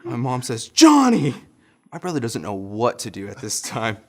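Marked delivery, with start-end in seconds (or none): 0.7-1.3 high, loud shout of a name; mom's angry yell. Retold or imitated. Mom rebuking the bitten boy's brother, shouted. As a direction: imitate a mother shouting at her child. Mom retold yelling at Johnny.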